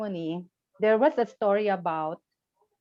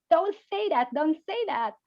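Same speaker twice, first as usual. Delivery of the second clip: imitating an angry husband, pitched higher; 7 semitones higher than usual. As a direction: acts out a furious husband lightly. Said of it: "the husband was so angry".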